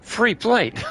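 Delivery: high, loud gleeful yell; excited shout. Real, not acted. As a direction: cheer it out with a laugh. Excited shout at game item.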